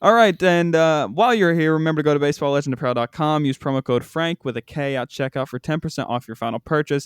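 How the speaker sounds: quick upbeat sponsor plug; host reads a merch ad to listeners, fast and punchy. Acted. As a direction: sell the promo code briskly to listeners. Podcast sponsor read.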